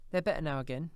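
a mother putting on a brave front, pitched high; Cratchit's wife. A narrator's reading. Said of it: "said Cratchit's wife".

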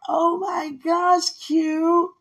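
high, slow, thrilled cry; excited squeal. Retold or imitated. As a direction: stretch out the delight, high. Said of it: "She was like".

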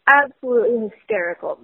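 ordinary delivery

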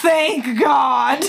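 high, loud, through laughter; laughing relief. Retold or imitated. Retold relief.

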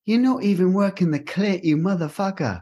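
high, scolding celebrity chef; Gordon Ramsay impression. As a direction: berate them like an outraged TV chef. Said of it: "my Gordon Ramsay impression".

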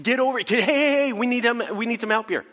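high, urgent yelling across the water; screaming for help. Retold or imitated. Fisherman yelling to neighboring boats for help, high and urgent. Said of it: "He starts screaming to other".

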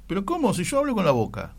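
high, mock-innocent student voice; playing dumb. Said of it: "Te haces el tonto primero".

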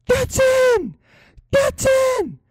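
very high, loud cheering yell. Retold or imitated. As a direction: scream it as your team scores. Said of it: "I was yelling so loudly".

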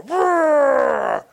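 high, loud playful roar; child's mock roar. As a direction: roar like a little lion. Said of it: "Let me hear you roar".

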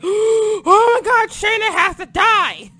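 loud dragged-out rage; furious outcry. Retold or imitated. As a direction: bellow it like a fan gone wild. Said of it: "I'm like".

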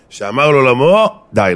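loud rising build, then a cut-off; emphatic proclamation. Real, not acted. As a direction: proclaim it loudly, swelling before the final word. Preacher cries out the punchline. (הכרזה נחרצת בקול רם)

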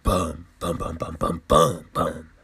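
low murmured boom boom booms, imitating drumbeats; low hushed boom effect; mock dramatic booms. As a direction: drop low and soft for mock drama beats. Mimics dramatic revelation beats.

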